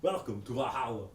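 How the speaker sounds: mock Arnold Schwarzenegger greeting; Arnold accent. As Crumb at the gate, in a mock Arnold accent. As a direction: welcome in a put-on Arnold accent. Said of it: "He talks like Conan, like Arnold".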